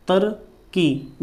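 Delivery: तरक्की drawn out slowly; slow spelled-out तरक्की; slow dictation. Shown as a demonstration. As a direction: stretch तरक्की while writing. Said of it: "दोनों को नहीं बनाना है".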